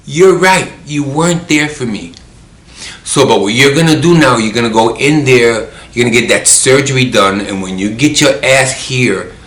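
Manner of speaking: stern retold scolding, starting high; retold telling-off. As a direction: lay down the law to a friend. Acting out her stern answer to friend.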